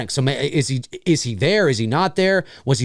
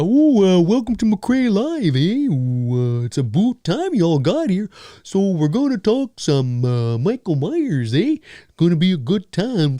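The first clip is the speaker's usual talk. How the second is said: hammed-up Canadian host voice, slow with a drawn "eh"; slow, exaggerated Canadian accent; mock Canadian accent; 3 semitones higher than usual. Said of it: "I just was like".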